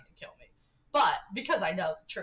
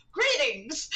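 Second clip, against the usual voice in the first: louder, higher cheery greeting; Ford's greeting; 6 semitones higher, 6 dB louder than usual. Retold or imitated. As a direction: burst out a hammed-up hello. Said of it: "Right after saying".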